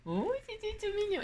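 high cooing over something cute; cutesy coo. Real, not acted. Crafter coos over cute cat stamps, high cooing. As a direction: coo at it like a kitten.

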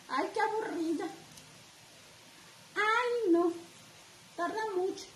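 high, whiny mock of a bored commenter; mocking impatient commenters. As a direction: pitch way up and whine it like an impatient viewer. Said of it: "Me mandan mensajitos en mis".

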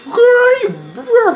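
high, loud laughing squeal. Real, not acted. Watching the fight, shrieking with laughter at the damage.

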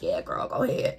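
soft whispered permission from Mother Earth. Pretend. Mother Earth answers her, in a hushed whisper. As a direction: whisper it like the earth granting a blessing. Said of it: "she was like".

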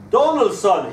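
high, loud call across a workshop. Retold or imitated. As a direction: imitate a father summoning his boy. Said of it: "he'd call".

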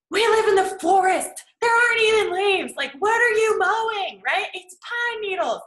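high, loud frustrated outburst at the lawn crew; exasperated rant. Retold or imitated. Fed up with leaf blowers in the forest, loud exasperated rant. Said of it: "I'm like".

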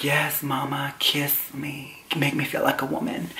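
soft, hushed, savouring delivery; mock swooning. To the guy kissing his neck, soft mock swoon. Acts swooning while being kissed.

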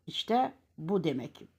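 ordinary delivery

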